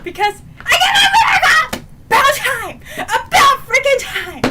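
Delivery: high, loud fan shriek; ecstatic shouting. Real, not acted. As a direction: shout it out, overjoyed at long last. Fan ecstatic at character's return.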